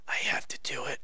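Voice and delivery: soft low whispered hero vow; mock hero whisper. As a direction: breathe it like a solemn mock vow. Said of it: "It's like".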